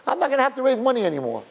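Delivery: high, thrilled gasp of hope; excited disbelief. Retold or imitated. Rabbi imagining a big donor check, high and thrilled. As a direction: squeal it like a windfall just landed. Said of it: "I was like".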